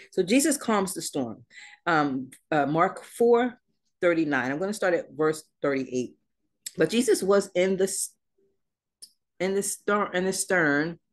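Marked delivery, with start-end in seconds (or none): none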